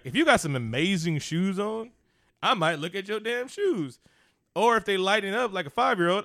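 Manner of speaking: normal talking voice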